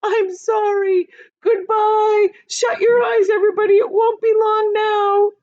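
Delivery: high, loud, crying goodbye; tearful shouted farewell. Acted. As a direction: James says goodbye to friends, crying. James's tearful farewell while falling.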